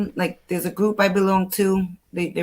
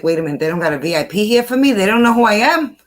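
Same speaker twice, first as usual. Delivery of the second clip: mock indignation; 3 semitones higher, 4 dB louder, faster than usual. Frequent flyer jokes about missing VIP treatment, mock indignant. Joking self-important outburst at the airport.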